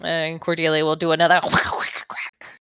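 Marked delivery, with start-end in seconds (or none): 1.8-2.6 breathy whispered whip crack noise. Acted. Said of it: "That was a horrible impression of a web crack".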